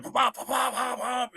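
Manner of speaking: high-pitched popping of a bus changing down gears; imitated engine popping. Storyteller imitating a bus chopping down gears, engine pops. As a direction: pop out the gear changes, high and punchy.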